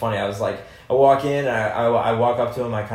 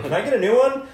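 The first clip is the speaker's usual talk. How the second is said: much higher, louder mock complaint; squeaky comic demand; 14 semitones higher, 6 dB louder than usual. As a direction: whine for a new bandmate. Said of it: "He's like".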